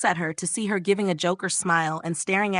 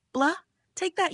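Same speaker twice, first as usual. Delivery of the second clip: high, childish mock taunt; tongue-out taunt; 10 semitones higher than usual. A narrator's reading. Friend sticking out her tongue at Bomb, taunting. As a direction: tease her in a raised pitch. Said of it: "sticks out her tongue like".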